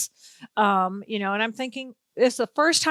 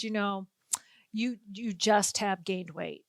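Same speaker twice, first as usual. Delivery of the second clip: soft, low and slow, as if breaking bad news; grave retold criticism; 3 semitones lower, 7 dB quieter, slower than usual. Older woman emails a TV host about her weight, grave and soft. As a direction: deliver it slowly and quietly, with weight.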